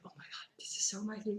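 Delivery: whispered retold reaction; awed whisper. Player seeing Ana introduced, awed whisper. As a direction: whisper it like a secret delight.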